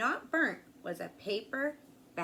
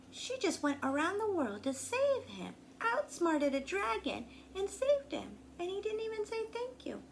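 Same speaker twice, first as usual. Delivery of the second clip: usual speaking voice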